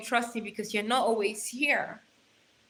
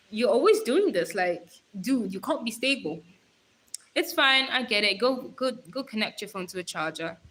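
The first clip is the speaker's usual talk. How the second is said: usual speaking voice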